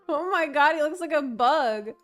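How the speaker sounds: ordinary talk, nothing marked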